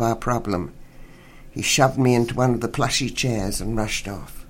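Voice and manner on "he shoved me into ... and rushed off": usual speaking voice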